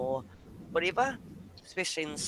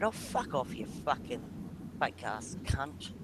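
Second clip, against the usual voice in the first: softer muttered swearing; swearing tirade; 5 semitones lower, 9 dB quieter than usual. Real, not acted. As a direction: curse under your breath at the screen. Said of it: "Oh, excuse me".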